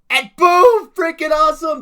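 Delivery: high, full-volume hype yell; excited shout. Retold or imitated. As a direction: explode into a high, loud cheer at the reveal. Reenacting excited reaction to a reveal.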